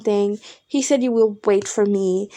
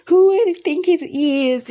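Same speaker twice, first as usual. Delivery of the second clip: higher, louder indignant demand; indignant outburst; 7 semitones higher, 3 dB louder than usual. Retold or imitated. To best friend about the ex, higher indignant outburst. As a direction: fling it out, offended. Angry retold rant about ex.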